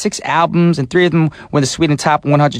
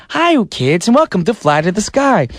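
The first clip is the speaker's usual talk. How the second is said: put-on cheery kids' TV host, a bit higher; kids' show host; 6 semitones higher than usual. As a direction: greet the kids like a children's show. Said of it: "sounds like a little kid's program".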